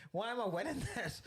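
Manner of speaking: high, laughing mock reply; laughing mock retort. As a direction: laugh as you play the barman. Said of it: "mocking the pub's reply".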